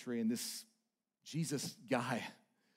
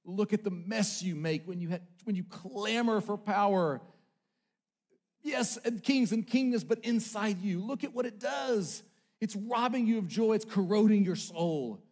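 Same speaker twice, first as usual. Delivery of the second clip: lightly voiced divine rebuke; a hint of scoffing God; 6 semitones higher, 5 dB louder than usual. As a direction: speak as God scolding the kings. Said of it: "he scoffs at them".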